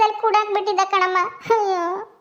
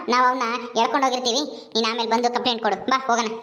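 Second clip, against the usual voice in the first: lower, brisk police officer voice; an officer giving quick orders; stern policewoman; 7 semitones lower, 3 dB quieter, faster than usual. Acted. Policewoman role held. (ಗಡುಸಾದ police ಅಧಿಕಾರಿಯ ಧ್ವನಿ)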